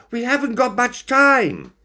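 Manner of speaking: high, urgent warning; panicked. A narrator's reading. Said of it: "Binnicky panicked".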